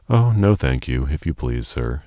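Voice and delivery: ordinary delivery, nothing marked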